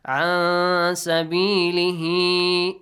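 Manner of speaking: slow, loud chant with the noon of "عن" held as a nasal hum; hidden noon. Shown as a demonstration. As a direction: melt the noon of "عن" into the seen of "سبيله". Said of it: "Hide the sound of nun".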